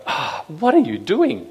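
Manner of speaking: high, slow, fed-up "Ah, what are you doing?"; exasperated sigh-question. Retold or imitated. God asking the fallen leaders, slow exasperated disbelief. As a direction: drag it out in disbelief. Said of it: "a real exasperation in God going".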